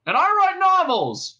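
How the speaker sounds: high, loud, slow boast; chiming in. A narrator's reading. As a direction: call it out proudly, drawn out. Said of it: "chimed in the other cop".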